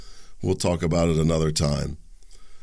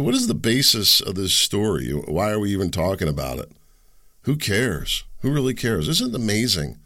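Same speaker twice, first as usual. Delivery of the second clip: usual speaking voice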